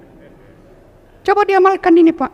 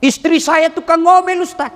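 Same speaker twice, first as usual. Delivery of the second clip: complaining husband; 9 semitones higher than usual. Pretend. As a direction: whine about the nagging wife.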